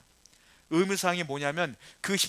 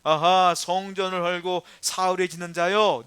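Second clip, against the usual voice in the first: loud, drawn-out taunting "아하"; acted public insult, loud and slow; mocking jeer; 6 dB louder, slower than usual. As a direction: jeer like a scoffing onlooker. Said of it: "뭐라고 욕했느냐면".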